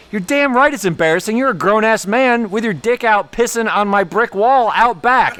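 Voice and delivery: loud, high-pitched angry retold tirade; not whispering. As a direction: chew him out so the whole room looks over. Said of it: "I'm not whispering to him".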